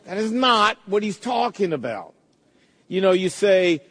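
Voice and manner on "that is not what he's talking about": ordinary talk, nothing marked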